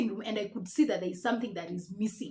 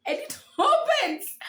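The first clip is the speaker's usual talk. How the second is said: laughing; 5 dB louder, slower than usual. Real, not acted. Balcony door was open.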